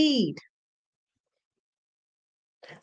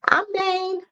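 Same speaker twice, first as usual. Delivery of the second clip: high, playful dog voice speaking for him; voicing the dog; 8 semitones higher than usual. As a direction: pet owner voicing her dog to the viewers, playful and high.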